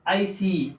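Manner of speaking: आईसी ending in a long ee; long final ee. Shown as a demonstration. Teacher showing the Y sound, long ee ending. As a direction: say आईसी with the last vowel held as ee. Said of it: "यहाँ पर बड़ी ई की आवाज".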